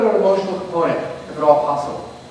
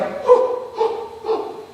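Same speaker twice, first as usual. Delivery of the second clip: mock gasp; 3 dB quieter than usual. Class reacts to reveal.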